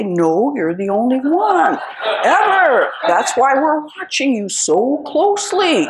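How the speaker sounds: loud, laughing sarcastic reply; laughing sarcasm. Retold or imitated. Daughter teasing forgetful mother, laughing sarcastically. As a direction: tease her through laughter.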